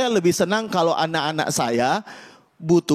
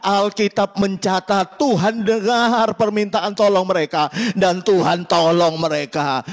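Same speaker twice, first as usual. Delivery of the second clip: normal talking voice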